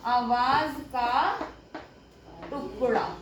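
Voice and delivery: teacher repeating a definition very slowly. Shown as a demonstration. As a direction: stretch आवाज का, pause, then टुकड़ा. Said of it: "क्या बोलते हैं".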